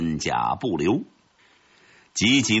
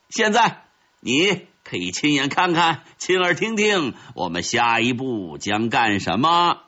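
lightly voiced victorious ant, higher pitched; boastful red ant; 6 semitones higher than usual. Red ant taunts its captured enemy with its plans, lightly boastful.

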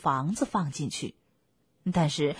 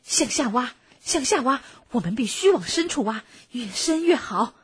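louder, higher urgent exclamation; urgent urging; 5 semitones higher, 5 dB louder than usual. Acted. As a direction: press them on with punchy urgency. Fox urges frantic digging to escape.

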